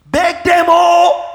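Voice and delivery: high, loud mock begging; acted loud plea. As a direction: cry it out in exaggerated exasperation. Acts out pleading to stop gifts.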